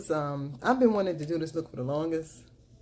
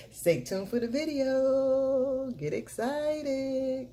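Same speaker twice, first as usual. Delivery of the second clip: much higher and very slow, stretching every word; drawn-out sign-off; 9 semitones higher, 3 dB louder, much slower than usual. Acted. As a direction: hold each syllable like a teasing jingle.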